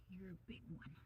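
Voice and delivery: soft whisper to an animal. Real, not acted. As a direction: breathe it gently. Whispered to the rabbit.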